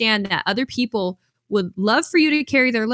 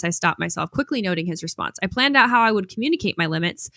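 ordinary talk

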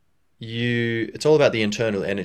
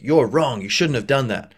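louder, higher accusing tone; judging; 4 semitones higher, 6 dB louder than usual. Acted. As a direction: pass judgement on them, emphatically. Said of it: "where you're internally judging them".

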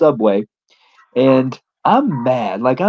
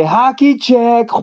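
high, drawn-out holler; yelled battle cry; 10 semitones higher, much slower than usual. Retold or imitated. Wrestler ramming his friend at the window, yelled battle cry. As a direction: call it out long and high like a charge. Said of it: "I say".

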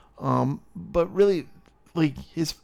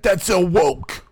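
loud, high, drawn-out mock grievance; mock complaint; 6 semitones higher, 7 dB louder, slower than usual. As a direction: stretch it out in exaggerated protest. Imagined modern outraged complaint.

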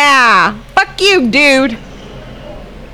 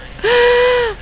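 gasp. Real, not acted. Shock while watching the film.

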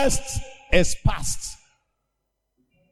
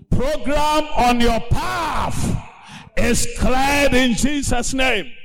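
pulpit-thumping healing decree; fiery preaching; 4 semitones higher than usual. Acted. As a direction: command the sickness out like a preacher. Preaching healing commands at full force.

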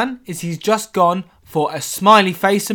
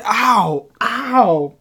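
mock pain; 5 semitones higher, 3 dB louder than usual. Hit head in VR.

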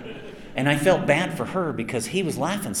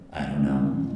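low, offhand shrug of a line; imitating the director; 9 semitones lower than usual. Director answering the voice actor over talkback, low and offhand. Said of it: "push that talkback button and go".

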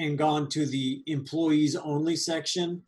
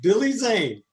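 louder and higher, stress on Billy; loud exclamation; 5 semitones higher, 6 dB louder than usual. Real, not acted. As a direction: punch the name out. Echoes name with emphasis.

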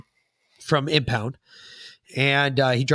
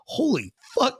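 amazed dad; 11 semitones higher than usual. Retold or imitated.

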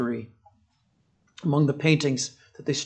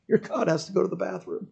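mocking; 4 semitones higher, 3 dB quieter than usual. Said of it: "He's just mocking them".

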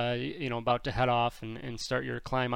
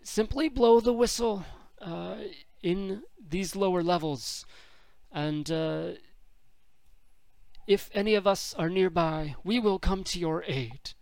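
high, raised alien voice; Sorgas voice; 7 semitones higher than usual. Acted. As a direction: pitch it up as the guide. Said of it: "Sorgas says".

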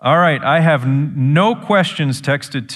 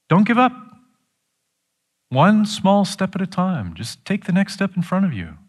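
high-pitched, earnest urging; coaxing someone onward, voice lifted high; earnest encouragement; 9 semitones higher than usual. Retold or imitated. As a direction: urge them on in a raised pitch. Preacher voices God encouraging Elijah.

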